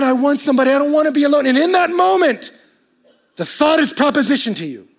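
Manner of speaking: loud, high, driving sermon delivery; preaching at full force. Acted. Pastor pressing the congregation about loneliness, at full force. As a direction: preach it out, building.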